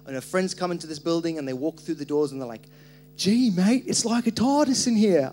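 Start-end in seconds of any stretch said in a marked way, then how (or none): 3.2-5.3 higher, louder Australian exclamation; Australian accent. Retold or imitated. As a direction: imitate an amazed visitor with an Australian accent. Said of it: "I don't know why that was Australian".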